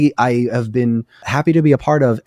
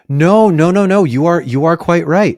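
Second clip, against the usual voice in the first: higher, louder rush of agreement; excited concession; 8 semitones higher, 5 dB louder than usual. Real, not acted. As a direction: concede eagerly, pitched up and loud. Eagerly concedes co-host is right.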